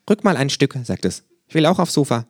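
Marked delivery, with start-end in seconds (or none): 0.1-0.8 etwas höher, verspielt; leicht gespieltes Känguru; Känguru-Stimme. A narrator's reading. Känguru korrigiert sich. 1.5-2.3 hohe, verspielte Känguru-Stimme. A narrator's reading. Känguru will aufs Sofa.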